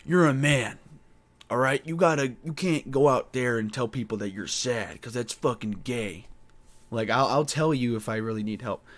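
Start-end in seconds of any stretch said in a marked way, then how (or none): 0.0-6.3 gruff dad laying down the law; tough-guy father lecturing his son; macho father voice. Retold or imitated. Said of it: "trained by their father to be like".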